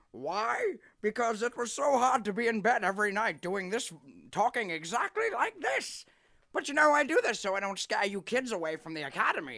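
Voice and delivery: high, loud, bouncy cartoon father; cheerful Lord Death. Lord Death explains to his son why mother is gone, high and jaunty.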